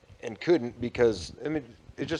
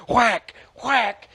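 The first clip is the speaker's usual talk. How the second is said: loud, high percussive sound effect; imitated hammer blows; 12 semitones higher, 5 dB louder than usual. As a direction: voice each blow of the hammer.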